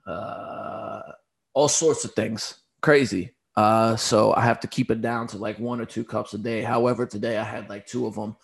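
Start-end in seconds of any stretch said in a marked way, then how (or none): none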